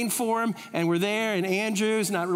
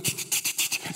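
camera clicks; 4 dB quieter than usual. Retold or imitated. Wife clicking photo sounds.

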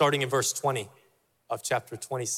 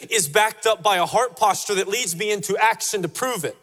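raised, driving sermon voice; fervent preaching; 7 semitones higher, 4 dB louder than usual. Acted. Preacher urging the church toward decision, at full force. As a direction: press the point home to the congregation.

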